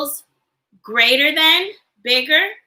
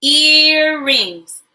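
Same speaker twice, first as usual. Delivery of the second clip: "Earrings" stretched into two long parts, loud; syllable split; 2 semitones higher, 5 dB louder than usual. Shown as a demonstration. Teacher breaking a word into parts, syllable split. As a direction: draw out each syllable of "Earrings". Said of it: "Break words into parts with me".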